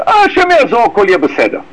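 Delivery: breezy, careless sigh, pitched well up; mock carefree shrug. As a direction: toss it off as if nothing's wrong. Mocking a carefree brush-off.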